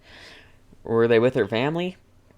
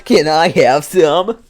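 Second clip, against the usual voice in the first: slow, louder deadpan meme ask; 4 semitones higher, 6 dB louder, slower than usual. Acted. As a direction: do the meme line again, slowly. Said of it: "that's the kind of a meme voice".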